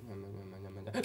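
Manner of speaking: hushed, voiceless murmuring; barely audible mumble before a jump scare; imitated quiet build-up. Shown as a demonstration. Co-host imitates the film's sound, hushed murmur. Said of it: "the sounds like this".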